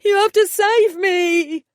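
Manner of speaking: high, loud and slow desperate wail; panicked plea. A narrator's reading. Trapped fairy begging to be saved, high panicked wail. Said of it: "His voice rose, matching his panic".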